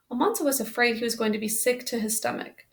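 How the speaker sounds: usual speaking voice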